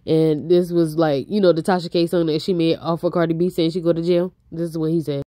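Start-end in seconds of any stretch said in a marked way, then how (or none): none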